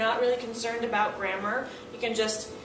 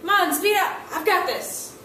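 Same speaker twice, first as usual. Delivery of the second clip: impatient urging; 6 semitones higher, 4 dB louder than usual. Retold or imitated. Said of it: "Quickly is go faster".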